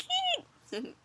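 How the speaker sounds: tiny high squeak; cat voice. Acted. The cat in the picture, squeaking high. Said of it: "the cat is kind of like".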